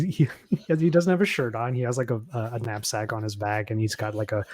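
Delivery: ordinary talk, nothing marked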